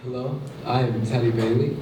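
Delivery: usual speaking voice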